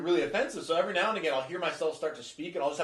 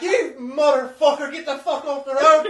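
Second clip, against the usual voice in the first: loud, high, drawn-out mock road rage in an Irish accent; angry Irish accent; 10 semitones higher, 11 dB louder, slower than usual. Driver swearing at people on the road, hammed-up furious Irish accent. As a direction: yell slowly like an Irish driver cursing. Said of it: "I swear at people in an Irish accent".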